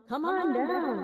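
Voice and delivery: loud, high host call-out; game show host. Acted. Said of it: "I sound like a game show host".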